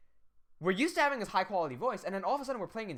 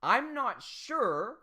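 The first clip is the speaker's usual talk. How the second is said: ordinary delivery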